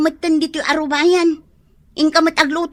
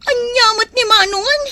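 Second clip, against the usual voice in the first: distressed question; 7 semitones higher, 3 dB louder than usual. Acted. Upset reaction to news.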